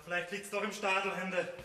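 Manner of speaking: normal talking voice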